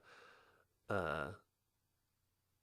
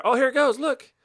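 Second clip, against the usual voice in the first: excited; 10 dB louder, much slower than usual. Real, not acted. Log about to fall.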